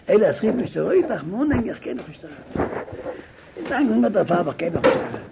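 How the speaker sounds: loud, high and rushed pleading; acted desperate protest. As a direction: cry out that it can't be done. (הויך, פארצווייפלט געבעט)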